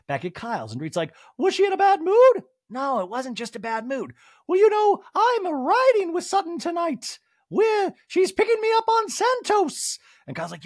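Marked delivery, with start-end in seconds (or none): 1.4-10.0 mock Dorit voice. Host mimicking Dorit telling Kyle her plans, high and loud.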